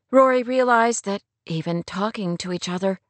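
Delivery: ordinary delivery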